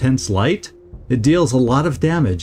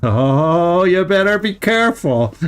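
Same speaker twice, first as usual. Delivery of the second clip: drawn-out warning rising higher toward a laugh; 5 semitones higher than usual. Real, not acted. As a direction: warn them while holding back real laughter. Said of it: "I shouldn't be laughing".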